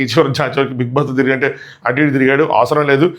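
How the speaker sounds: ordinary delivery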